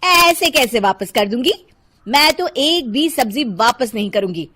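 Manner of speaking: quarrelsome, pushy housewife; bossy Shilpa. Acted. Housewife threatening the poor vegetable seller, bossy and indignant. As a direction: lay down the law to the vendor.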